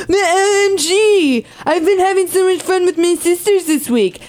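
put-on high, gushing valley girl quote; mock gushing sorority girl. Mocking sorority girl's gushing.

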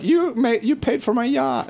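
high-pitched mock therapist; hammed-up high quote; joking therapist impression. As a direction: voice a delighted therapist, pitched well up. Co-host voices therapist, joking.

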